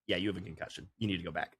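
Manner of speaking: low, quiet mock medic; imitating a medic quietly delivering news; medic's verdict. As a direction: give the diagnosis low and understated. Acting medics giving verdict.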